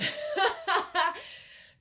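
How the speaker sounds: loud high burst of laughter; big laugh. Real, not acted. Laughing at mixing accents.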